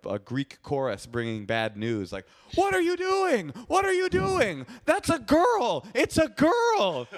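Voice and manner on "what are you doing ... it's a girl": high shrieking, slow panic; mock screaming. Friends shrieking at J J, high and slow. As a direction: shriek it like a Greek chorus. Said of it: "they started screaming".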